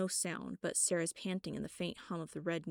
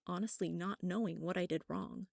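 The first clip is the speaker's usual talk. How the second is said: usual speaking voice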